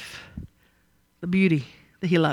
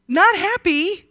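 higher still, leaning on not; emphatic; 9 semitones higher, 4 dB louder, slower than usual. Pretend. Preacher repeating Laban's displeasure, pitched up higher. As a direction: lift not up high in mock outrage.

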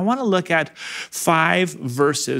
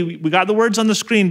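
normal talking voice

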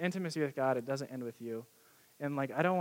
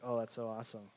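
polite disinterest; 5 semitones lower, 9 dB quieter than usual. Retold or imitated. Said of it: "She doesn't care anything about Seattle".

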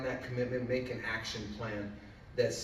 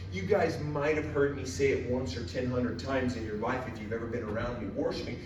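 usual speaking voice

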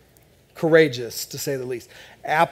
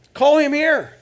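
higher, louder call across a crowd; 12 semitones higher, 6 dB louder than usual. Acted. Crowd calling the blind man to Jesus, loud and high. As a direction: call him over to Jesus. The crowd calls Bartimaeus over.

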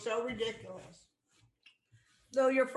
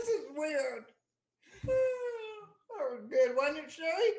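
recovering from laughter; 5 semitones higher than usual. Real, not acted. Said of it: "you're choking".

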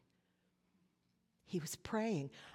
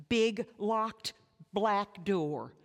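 1 slow and heavy; 5 dB louder, much slower than usual. Acted. Dramatic image of death.

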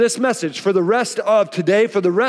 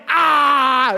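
high, drawn-out tantrum "aah"; mock tantrum yell; 6 semitones higher than usual. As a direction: imitate a small kid's yell. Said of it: "start yelling".

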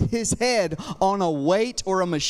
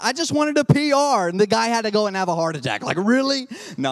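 usual speaking voice

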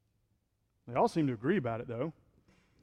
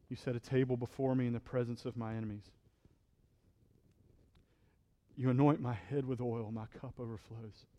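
scripture recitation; 5 semitones lower, 9 dB quieter than usual. Acted. Recites Psalm twenty-three.

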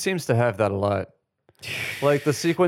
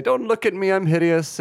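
diva wail; 8 semitones higher than usual. Retold or imitated.

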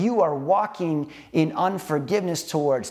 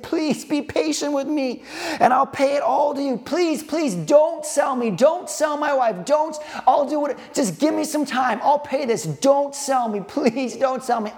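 desperate pleading; 10 semitones higher than usual. Acted. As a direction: beg for your wife and children not to be sold. Servant begs master for family.

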